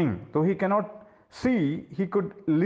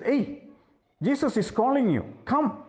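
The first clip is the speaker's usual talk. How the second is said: high, quick call to come; urgent summons; 7 semitones higher than usual. Acted. Men running to fetch the blind man, urgent summons. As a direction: call him over briskly, pitch raised.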